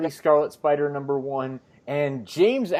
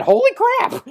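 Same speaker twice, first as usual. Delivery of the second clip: a sudden much higher, squealed exclamation; holy crap exclaim; 5 dB louder than usual. Real, not acted. Realizing the silly comic dialogue is real, shocked squeal. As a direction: blurt it out in disbelief.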